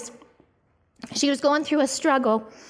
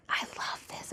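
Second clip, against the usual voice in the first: whisper; 16 dB quieter than usual. Real, not acted. Aside to listeners mid-sermon.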